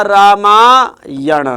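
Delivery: रामायण said very slowly, ending in retroflex ण; slow careful sounding out. Shown as a demonstration. Teacher sounding out रामायण slowly for students. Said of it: "ये रामायण नहीं".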